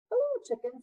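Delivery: squeaky high duck greeting. Acted. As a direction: chirp the hello way up high. Duck greets farm animals.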